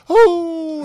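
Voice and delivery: high, loud wolf howl. Pretend. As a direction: throw back your head and howl like a wolf. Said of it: "that's my wolf howl. It wasn't very good".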